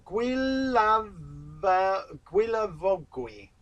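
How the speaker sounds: drawn-out, halting attempt at a hard name; struggling pronunciation. Real, not acted. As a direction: sound the name out slowly, piece by piece. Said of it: "I can't even pronounce his name".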